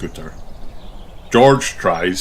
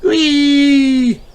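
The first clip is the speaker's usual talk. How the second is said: high, loud delighted squeal; happy cry; 6 dB louder, much slower than usual. Acted. Peppa cheers George on his scooter, squealing. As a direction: cheer him on with pure joy. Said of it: "cries Peppa happily".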